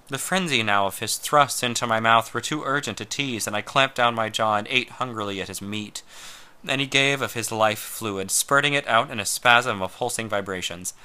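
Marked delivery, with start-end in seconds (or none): none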